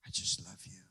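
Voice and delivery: breathy whisper of love to God; hushed adoration; whispered prayer. Pretend. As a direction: whisper it tenderly.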